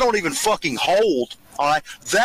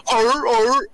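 mock dog bark; 4 semitones higher than usual. Host mocking Ralph as a dog watching math, mock barks.

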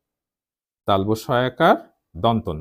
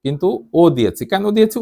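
normal talking voice

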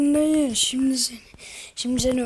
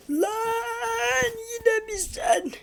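high, slow wailing cartoon voice; turkish cartoon dub; 10 semitones higher, 5 dB quieter, much slower than usual. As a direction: cry it out like a cartoon villain. Character voice crying out. (yüksek, ağlamaklı çizgi film sesi)

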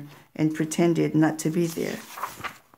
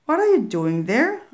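a hint of a surprised mother, higher and louder; lightly voiced startled mom; mother's voice; 6 semitones higher, 4 dB louder than usual. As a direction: mom finding the kids under the bed. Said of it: "It sounded like my mother".

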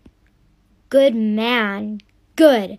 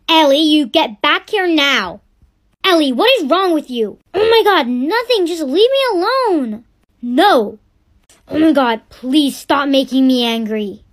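louder, clipped yelling at each other; heated argument; 6 dB louder than usual. Acted. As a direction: shout back in a family fight. Mother and daughter argue.

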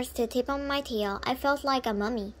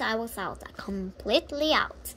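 plain reading voice

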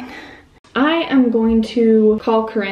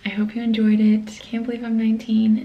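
ordinary delivery

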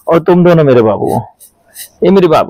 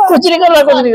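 raised, playful protest; mock pleading; 9 semitones higher, faster than usual. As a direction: plead innocence up high, half-joking. Parent pleading with hitting kids. (ऊँची आवाज़ में मज़ाकिया सफ़ाई)

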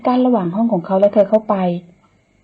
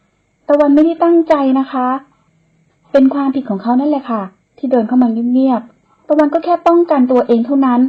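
slow, higher, sing-song self-defence; pleading excuse; 6 semitones higher, 4 dB louder than usual. Acted. Young woman insists to nanny it wasn't her fault, pleading. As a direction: make your excuse sound innocent.

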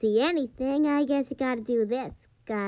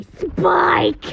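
Spike voice; 3 semitones higher, 6 dB louder than usual. Retold or imitated. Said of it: "you could also say".

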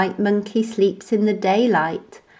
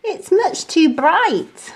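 high whiny grumble; grumpy monkey voice; complaining monkey; 9 semitones higher, 3 dB louder than usual. A narrator's reading. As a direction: moan about the light.

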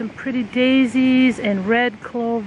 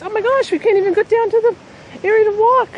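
higher, louder alarmed exclaim; 11 semitones higher, 4 dB louder than usual. Real, not acted. Blocked by swarming bees, she cries out alarmed. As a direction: panicky at the bee swarm.

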